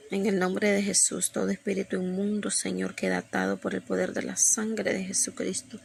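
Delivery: softly spoken binding prayer; quick aside prayer. Real, not acted. Breaks off to pray binding spirits.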